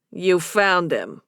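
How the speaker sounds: low, slow Boston bartender voice; Boston barman, low and slow; thick Boston accent. A narrator's reading. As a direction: take your time in a Boston accent. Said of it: "a man with a thick Boston accent".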